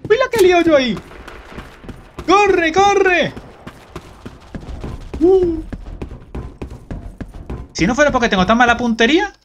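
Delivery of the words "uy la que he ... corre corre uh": real giddy excitement; excited yelps. Real, not acted. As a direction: whoop at the chaos you caused. Chaotic kill streak in game.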